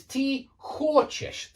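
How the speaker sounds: хочешь with a strong, high first syllable; stress on first syllable. Shown as a demonstration. Teacher shows learners the stress changes, хочешь stressed at the front. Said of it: "The stress changes".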